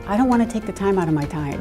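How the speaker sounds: usual speaking voice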